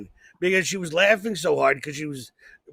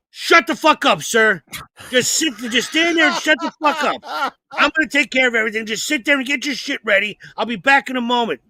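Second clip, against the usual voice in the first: higher, louder, barking the order; stern cop order; 5 semitones higher, 4 dB louder than usual. Retold or imitated. Woman cop ordering the panicking driver to shut up, harsh and louder. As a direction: cut him off and take command.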